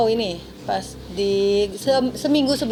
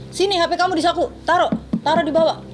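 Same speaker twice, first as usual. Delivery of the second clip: higher-pitched imitated order; mock insistent command; retold demand; 6 semitones higher than usual. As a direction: act out a boss demanding compliance. Retold employer demanding the phone.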